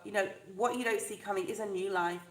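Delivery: ordinary delivery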